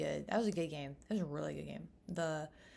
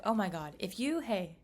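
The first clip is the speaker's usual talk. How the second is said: ordinary talk, nothing marked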